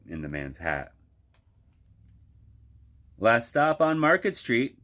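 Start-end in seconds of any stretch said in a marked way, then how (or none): 3.2-4.8 higher, louder driver's announcement; called out. A narrator's reading. Bus driver calls the last stop to passengers, loud. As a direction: call the stop to the whole bus. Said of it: "Mister Dennis called".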